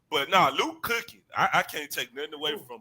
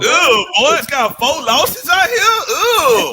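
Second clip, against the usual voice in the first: mocking disgust; 9 semitones higher, 12 dB louder, slower than usual. Mocking the team's losses.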